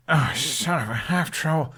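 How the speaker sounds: lightly voiced grumbling curse; cursing. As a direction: Hiccup swearing as the mist swallows him. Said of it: "Hiccup cursed".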